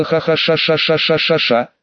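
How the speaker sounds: long hissing like an angry cat. A narrator's reading. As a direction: hiss and spit like a cat. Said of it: "запишила ... как кошка".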